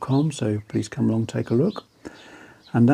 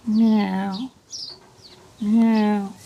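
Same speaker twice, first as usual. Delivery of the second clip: high cat meow; 10 semitones higher than usual. Pretend. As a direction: meow like a kitten. Cat noise while introducing cat.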